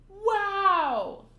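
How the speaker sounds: high, loud sound effect; loud wow effect. Acted. Sound effect read aloud.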